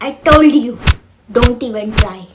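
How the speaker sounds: loud stern warning. Acted. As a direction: warn him off, loud. Sibling warns loudly. (ज़ोर से चेतावनी)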